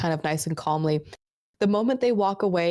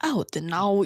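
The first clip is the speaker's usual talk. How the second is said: usual speaking voice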